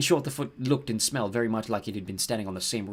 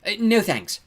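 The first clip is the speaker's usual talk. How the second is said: high, loud, over-polite refusal; trying to sound grateful; 11 semitones higher, 7 dB louder, slower than usual. A narrator's reading. Girl turns down giant's offer, trying to sound grateful. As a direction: decline as if truly grateful. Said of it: "trying to sound grateful for the offer".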